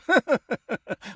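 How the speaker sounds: short amused laugh. Real, not acted. Narrator chuckles at the hungry wolf, laughing. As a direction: chuckle at the wolf's plight.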